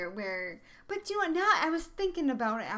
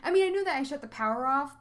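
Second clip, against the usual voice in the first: ordinary talk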